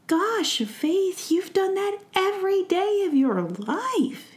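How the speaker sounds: high, eager fairy prince; excited. Acted. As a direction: gush with wonder at a friend. Orion praises his friend.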